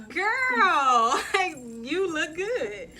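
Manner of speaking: high, loud, stretched-out hyping; hammed-up amazed compliment with a laugh; excited retold reaction. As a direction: reenact gushing over a friend's makeover. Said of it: "I was like".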